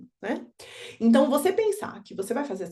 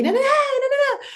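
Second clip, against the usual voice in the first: put-on whiny child; 5 dB louder than usual. Daughter's rant mocked.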